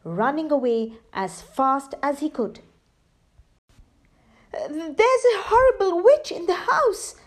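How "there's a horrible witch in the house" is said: high, louder breathless warning; high frightened panted line; panting terror. Acted. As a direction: gasp it out after running. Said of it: "he panted".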